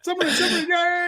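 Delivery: put-on high, giddy childlike cheer; Scott Morrison. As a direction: squeal with mock excitement. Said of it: "how excited he is".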